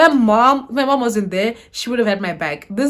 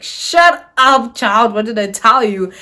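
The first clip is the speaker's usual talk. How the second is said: loud, exasperated telling-off; retold scolding; 6 dB louder, slower than usual. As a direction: cut the child off with a loud reprimand. Director scolds her in retelling.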